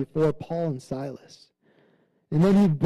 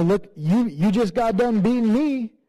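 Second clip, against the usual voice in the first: higher and louder, a hammed-up gloat; mock gloating taunt; 6 semitones higher, 5 dB louder than usual. Paul to the jailer, imagined taunting, hammed-up gloat. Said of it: "Or, you know".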